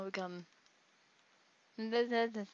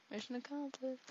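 mission accomplished said softer and higher in a put-on voice; 5 semitones higher, 8 dB quieter than usual. Shown as a demonstration. Gamer repeating his win line softly in a higher voice. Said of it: "second take, changed voice".

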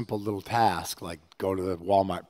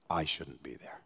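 whispered plea; 5 semitones lower, 11 dB quieter than usual. Acted. Tender prayer quote.

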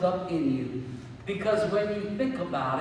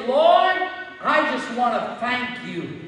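loud, raised, rhythmic litany of thanks; fervent thanksgiving prayer; 6 semitones higher, 9 dB louder than usual. Shown as a demonstration. Preacher modelling a homeowner's thanks to God, fervent. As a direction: pray it out forcefully, each thanks hammered home.

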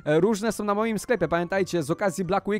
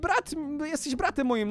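pleading; 8 semitones higher than usual. Pretend. Pleading with evil brother.

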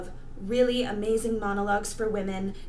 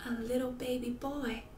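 softer, wistful echo; tragic heroine sorrow; 2 semitones lower, 7 dB quieter, faster than usual. Acted. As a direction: let it fall away. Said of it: "performing a monologue for you".

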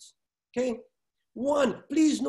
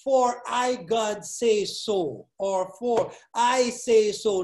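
slow hypothetical; much slower than usual. Shown as a demonstration. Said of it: "God could have said".

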